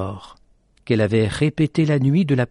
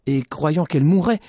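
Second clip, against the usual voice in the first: ordinary delivery, nothing marked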